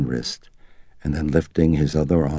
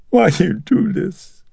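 put-on high, pleading old mother's voice; 6 dB louder than usual. A narrator's reading. Mother pleading with her son, high and sorrowful. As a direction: beg him like a hurt old mother.

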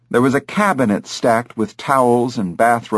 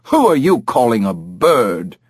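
offended bird. Acted.

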